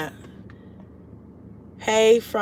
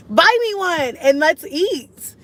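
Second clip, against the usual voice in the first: playful begging; 8 semitones higher, 4 dB louder, much slower than usual. Acted.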